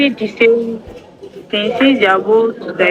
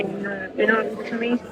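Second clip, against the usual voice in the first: faint, fading out of reach; very faint, almost lost; barely audible; 10 dB quieter than usual. Real, not acted. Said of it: "I only heard the last part of your question".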